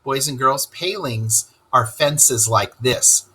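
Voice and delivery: usual speaking voice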